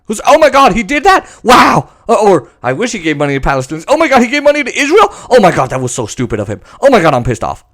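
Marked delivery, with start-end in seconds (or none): 0.2-1.9 mock outrage. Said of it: "friends who talk like that". 2.6-7.6 rattled off fast in mock indignation; fast hammed-up complaining; mock outrage. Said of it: "friends who talk like that".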